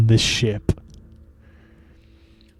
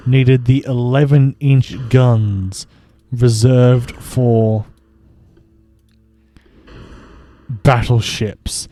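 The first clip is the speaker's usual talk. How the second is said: slow for effect; slower than usual. Acted. Mocking the Admiralty's choice, slow and grand. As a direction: drag out the eleven inch guns slowly, mock grand. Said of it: "mock-grand British battleship".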